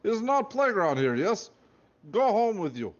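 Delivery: loud, high Russian guard voice; Russian accent. Acted. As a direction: shout it in a thick Russian accent. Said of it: "He had a thick Russian accent".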